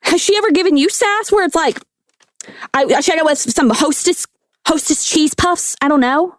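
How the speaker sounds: high Southern gossip; Southern accent. Acted. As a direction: ask in a put-on Southern lady voice. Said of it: "talk in a Southern accent, please".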